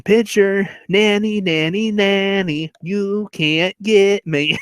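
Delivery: high nanny-nanny singsong; taunting. Retold or imitated. Henry at second base taunting the pitcher, singsong. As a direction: tease like a playground chant, stretching the ending. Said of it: "and still taunting him".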